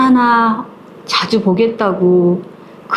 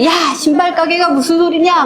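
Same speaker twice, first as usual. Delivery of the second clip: higher, strained scolding of an outraged father; gruff father scolding; 8 semitones higher than usual. Retold or imitated. Father scolding his widowed daughter, raised disapproving voice. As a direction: bark it like a father who won't allow it. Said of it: "친정 아버지는".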